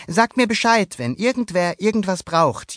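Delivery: put-on high, loud boyish voice; boy addressing the herd. A narrator's reading. Luca tells his goatfish to speak up, high voice. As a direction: tell the herd kindly as young Luca.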